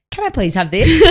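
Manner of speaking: high little-kid request, imitated; child asking politely. Son retrying after mum's silence, high imitated child voice. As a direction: ask high, as a corrected child. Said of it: "you just hear Bailey go".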